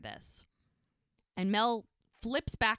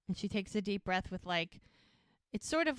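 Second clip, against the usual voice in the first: ordinary talk, nothing marked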